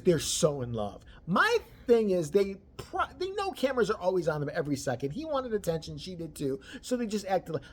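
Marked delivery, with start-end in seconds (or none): none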